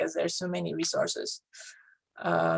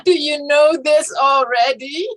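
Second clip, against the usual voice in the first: higher and louder, laughing disbelief; incredulous retort; 7 semitones higher, 8 dB louder than usual. Retold or imitated. Mother retorting to the teacher at a parent meeting, incredulous and laughing. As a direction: fire it back, half laughing in disbelief. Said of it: "I'm like".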